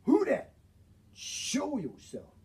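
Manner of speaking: high-pitched hobo challenge, slowing on the demand; alarmed hobo. Acted. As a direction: demand an answer from someone hidden. Hobo demands hidden thrower show himself.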